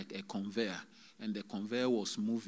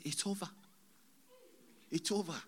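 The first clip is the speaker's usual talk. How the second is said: higher-pitched pronouncement of doom; retold voice from the vision; 7 semitones higher, faster than usual. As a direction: announce it high, without raising volume. Voice heard in the vision.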